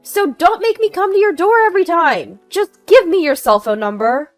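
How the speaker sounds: high, very loud order; angry demand. Acted. Angry neighbor demanding phone number.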